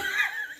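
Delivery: short, very high giggle; high laugh. Real, not acted. Confessing the double dip, giggling high. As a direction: squeal a laugh.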